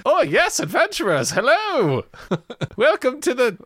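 high, squeaky tavern host voice with laughs; bright squeaky sing-song welcome. Acted. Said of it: "I can hear you going there".

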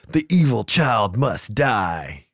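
very slow, menacing mock-dramatic voice; drawn-out, doom-laden delivery; ominous note reading. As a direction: intone it like a horror-movie threat. Reads threatening note in dramatic voice.

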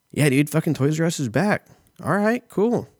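excited. Real, not acted. Hyped about Toys R Us return.

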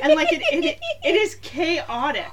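laughing while ranting. Real, not acted. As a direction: talk through the laughter. Laughing about the show.

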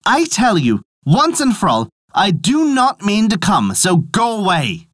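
high, emphatic refusal, slowing down; exclaiming. A narrator's reading. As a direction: exclaim it firmly, spacing out the final words. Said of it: "he exclaimed".

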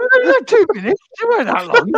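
laughing protest. Real, not acted. Defending himself, laughing.

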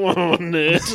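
mock taunting. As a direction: taunt him like a schoolyard bully. Mocking bullies in the background.

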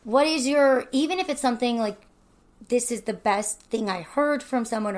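ordinary delivery

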